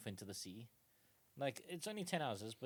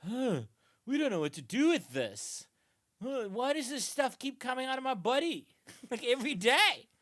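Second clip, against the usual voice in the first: higher, louder dim-witted puzzlement; baffled medieval folk; 10 semitones higher, 8 dB louder than usual. Retold or imitated. Post-Roman people confused by their waste, mock bafflement. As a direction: play it like clueless peasants. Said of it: "just everyone's like".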